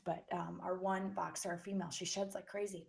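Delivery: ordinary delivery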